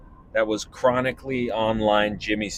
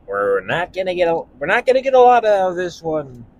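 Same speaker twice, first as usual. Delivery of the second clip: a lightly put-on higher voice; comic impression; 8 semitones higher, 4 dB louder than usual. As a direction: slip half into a character voice. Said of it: "That's my other impression I can do".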